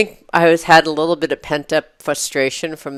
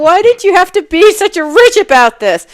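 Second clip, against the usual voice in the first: retold outburst; 13 semitones higher, 6 dB louder than usual. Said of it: "I was like".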